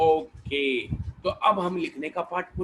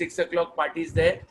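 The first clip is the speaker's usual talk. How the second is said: ordinary talk, nothing marked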